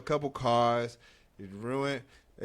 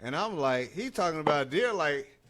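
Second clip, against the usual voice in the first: usual speaking voice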